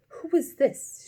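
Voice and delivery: sharp, severe queen. A narrator's reading. Queen severely questioning the Knave. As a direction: demand it sternly. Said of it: "the queen said severely".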